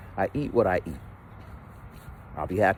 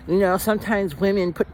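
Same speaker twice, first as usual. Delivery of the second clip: lightly raised mock girl voice; a touch of her condescending tone; imitating his date; 10 semitones higher than usual. Date explaining bras to him, in a lightly higher mock voice. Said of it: "she starts explaining it like I'm in it".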